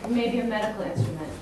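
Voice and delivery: ordinary delivery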